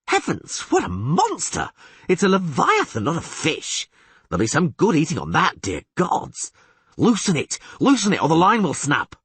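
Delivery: put-on high, eager, excitable young bard; excited bard voice. Dandelion marvels at the huge catfish, high and excited.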